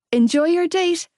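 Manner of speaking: high, light parting call; called over shoulder. A narrator's reading. Teasing friend on her way out, calling over her shoulder. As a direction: call it back lightly as you leave. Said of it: "I called over my shoulder".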